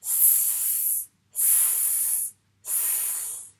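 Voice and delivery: "s" as a long voiceless hiss, softly; soft, sustained "s", separated by pauses; isolated s sound. Shown as a demonstration. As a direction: draw out a pure "s" with no vowel, three times. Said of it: "peut faire le son".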